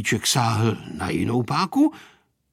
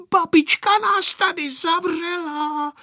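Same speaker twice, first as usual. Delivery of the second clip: put-on high, drawn-out girl's call; plaintive call in a much higher voice. A narrator's reading. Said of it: "volala Aneška".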